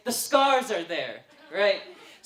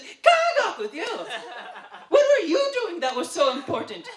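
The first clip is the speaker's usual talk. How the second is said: loud, very high mock yell; hammed-up shriek of a sister telling off her brother; imitated sister's scolding; 9 semitones higher, 7 dB louder than usual. Sister scolding latecomer at the gate, high, loud mock anger.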